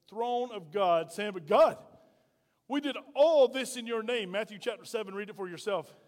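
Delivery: ordinary talk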